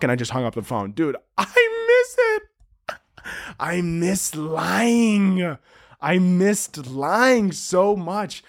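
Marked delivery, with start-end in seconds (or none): 1.4-8.4 high, very drawn-out wail; mock lament, stretch each word up high; hammed-up longing.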